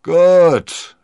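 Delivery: loud grunt of assent; big, high-pitched gruff reply; gruff captain. A narrator's reading. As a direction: concede it loudly, like a grumbling skipper. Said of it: "brummte der Kapitän".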